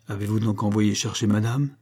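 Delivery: ordinary delivery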